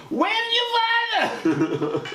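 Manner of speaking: extremely high, stretched-out screech; screeching accented mother. Acted. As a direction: drag the screech out absurdly. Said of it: "what even is this accent".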